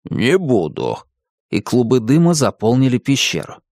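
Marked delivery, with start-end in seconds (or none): 0.0-1.0 low dragon refusal; deep-voiced, huffy beast; snorting dragon. A narrator's reading. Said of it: "фыркнул дракон".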